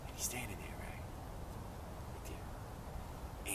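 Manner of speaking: barely voiced, very soft storytelling; hushed suspense. A narrator's reading. Storyteller as disguised toad waits under tree, hushed. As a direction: drop almost to a whisper for suspense. Suspense before the mouse comes down.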